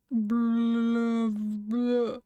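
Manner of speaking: drawn-out wordless monster groan; long, blubbering mock groan; unintelligible groan. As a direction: groan out nonsense as a creature. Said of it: "Unintelligible groans".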